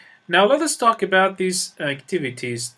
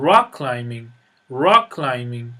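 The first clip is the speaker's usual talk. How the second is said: rock climbing stressed on rock, louder; stress on first part; 4 semitones lower, 4 dB louder than usual. Shown as a demonstration. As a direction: hit rock hard, climbing light. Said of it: "the stress is on the first syllable".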